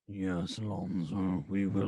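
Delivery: deep, scary villain voice; LeVar Ball. Acted. Father answering Lonzo, deep scary voice. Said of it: "LeVar Ball voice".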